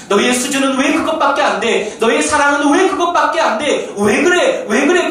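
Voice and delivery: high, loud nagging demand; mock scolding. Preacher mimicking someone scolding a partner, loud and high.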